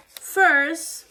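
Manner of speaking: ordinary talk, nothing marked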